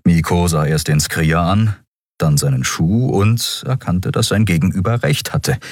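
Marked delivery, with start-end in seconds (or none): none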